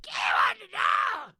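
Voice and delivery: high, shouting weird Southern lady; grandma impression. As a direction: call for Betty like a shrill old Southern grandma. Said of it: "that was her voice".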